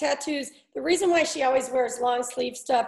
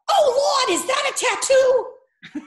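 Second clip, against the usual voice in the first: high, horrified Boston mother; shocked Boston mom, high and loud; Boston accent; 12 semitones higher, 5 dB louder, slower than usual. Retold or imitated. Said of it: "who had a big Boston accent".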